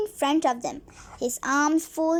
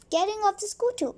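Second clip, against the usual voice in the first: usual speaking voice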